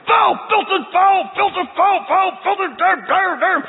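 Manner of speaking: high, loud hammed-up cussing; mock cursing tirade. Fisherman cussing out the pastor, hammed-up loud tirade. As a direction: rattle off the bleeped curses in a rage. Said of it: "we're talking".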